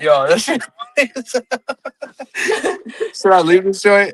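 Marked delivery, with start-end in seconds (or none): 0.7-3.1 high, breathy burst of laughter at the lag; real laugh. Real, not acted. Guest laughing as the laggy call falls apart, amused laugh.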